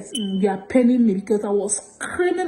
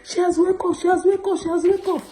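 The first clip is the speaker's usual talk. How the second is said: raised high, repeating excitedly; calling out that she woke up, voice pitched up; husband's excited news; 8 semitones higher than usual. Retold or imitated. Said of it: "my husband say".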